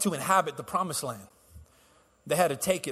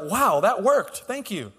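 sarcasm; 3 semitones higher, slower than usual. Retold or imitated. Preacher imitates wife told to calm down, sarcastic. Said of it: "That's the sarcasm that comes out".

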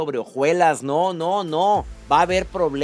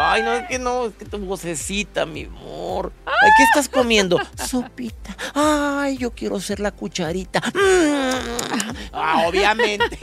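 giggly late night call; 6 semitones higher than usual. Pretend. Mock lovers' phone call.

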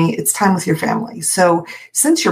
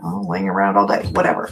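mocking air quotes; 11 semitones lower than usual. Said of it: "I hate air quotes".

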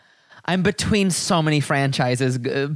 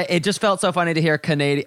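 ordinary talk, nothing marked

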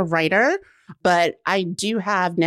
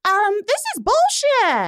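high, slow, drawn-out mock reply; deadpan retort; 12 semitones higher than usual. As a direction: drag it out, unimpressed. Retold own blunt reply.

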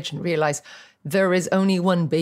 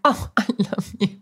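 laughing fond reply; 2 semitones higher than usual. Retold or imitated. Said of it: "And you're like".

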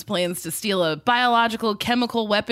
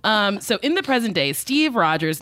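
usual speaking voice

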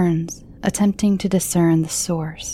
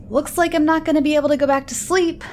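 high, fast frustrated outburst; frustrated yell; 9 semitones higher, faster than usual. Acted. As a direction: blurt it out, fed up. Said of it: "I yell in frustration".

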